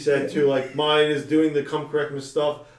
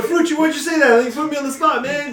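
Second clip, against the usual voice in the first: exasperated shout; 10 semitones higher, 4 dB louder than usual. Retold or imitated. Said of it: "and I'm like".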